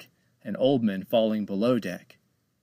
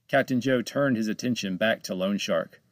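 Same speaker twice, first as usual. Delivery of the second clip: plain reading voice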